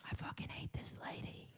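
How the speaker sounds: hushed whispered aside. Retold or imitated. Muttering about the rude puppy lady beside him, whispered. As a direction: whisper it so the seatmates won't hear.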